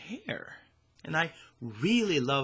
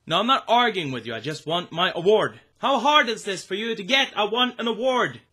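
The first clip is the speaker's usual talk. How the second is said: whiny demanding; 7 semitones higher, 4 dB louder than usual. Acted. Demanding award, getting worked up.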